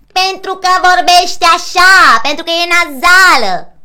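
loud, high, drawn-out neighbour screech; screechy annoying neighbour. Shown as a demonstration. Coach shows a singer the neighbour, screechy. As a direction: screech it like the hated neighbour through seven walls. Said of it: "să fie cât mai țipător".

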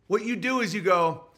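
normal talking voice

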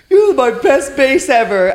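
high, slow, loud mock toast; mock announcer. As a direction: announce it grandly. Mocking her at banquet.

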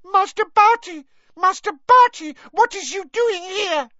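high, loud house-elf shriek; piercing shriek. Acted. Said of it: "she let out a piercing shriek".

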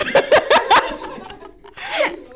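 high, loud burst of laughter at the muddle; big real laugh. Real, not acted. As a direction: crack up in disbelief. Host cracks up at failed explanation.

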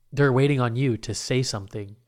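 ordinary talk

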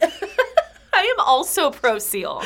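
slow, laughing declaration; giggling pro seal pledge. Real, not acted. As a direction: drawl it out through laughter. Laughing agreement.

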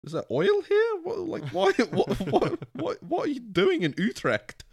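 high-pitched, incredulous questions breaking into laughter; mock bewilderment. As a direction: squeak out baffled questions, half laughing. Acted bafflement at ambushing Americans.

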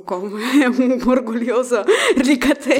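laughing through the words; teasing while cracking up. Real, not acted. Partner teasing, laughing at him.

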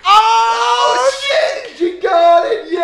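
very high, loud shouted cheer; cheering crowd. Retold or imitated. Kids at the pool cheering the aunt's catch, shouted. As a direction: whoop like the whole pool erupted. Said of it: "Everyone would be like".

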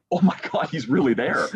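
thrilled can't-believe-it squeal; excited disbelief. Retold or imitated. Disbelief, high and laughing.

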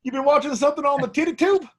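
high-pitched mock Southern accent. Teasing co-host about the tube, hammed-up Southern. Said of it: "Southern episode".

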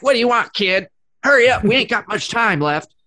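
high, loud impatient elf; christmas elf. Retold or imitated. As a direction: bark at the kid like a cranky mall elf. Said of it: "[name]'s elf impression".

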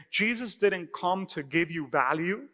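usual speaking voice

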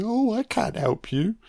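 high, swooping mock-pitying voice; Potter. Potter turning George away, mocking put-on voice. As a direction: feign helplessness in a put-on voice. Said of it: "Potter's like".

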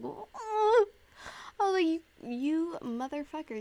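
high, loud disbelieving "What?"; high startled "What?"; shocked what. Retold or imitated. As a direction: yelp it in sudden disbelief. Hears it costs more.

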